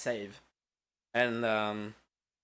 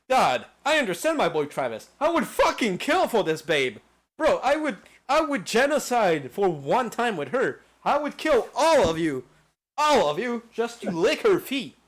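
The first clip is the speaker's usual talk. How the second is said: higher, louder, over-the-top gushing; mock-crazed fan; 5 semitones higher, 5 dB louder than usual. Joking to friends about a game babe, higher and louder in mock frenzy. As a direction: ham up a lovestruck gamer. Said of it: "But I'm like".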